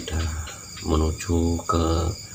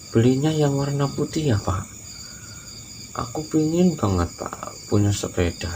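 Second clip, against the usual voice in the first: anak kecil merengek; 7 semitones higher than usual. Acted. Anak merengek minta sepeda.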